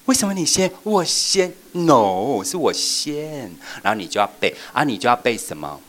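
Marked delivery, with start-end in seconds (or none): 0.1-3.5 high, loud, then slow drawn-out protest; mock child arguing over who goes first; competing child. Shown as a demonstration. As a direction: insist it is my turn. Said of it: "那他就会想说".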